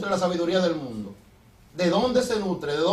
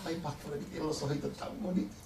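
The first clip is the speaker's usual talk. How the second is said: smitten sister; 5 semitones lower, 13 dB quieter than usual. Retold or imitated. Preacher voices a smitten sister.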